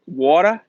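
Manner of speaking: "water" with no R at the end; New England accent. Retold or imitated. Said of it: "they couldn't pronounce the R's".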